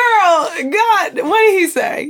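skeptical. Retold or imitated. Doubting listener quizzing someone who claims God spoke, skeptical. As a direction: challenge it like you don't buy a word. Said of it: "I was always very skeptical".

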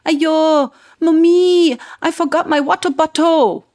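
put-on high, loud Singapore English child voice. Three-year-old daughter home from daycare, upset, Singapore English. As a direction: do a little girl in full Singapore English. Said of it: "spoke full-on Singapore English to me".

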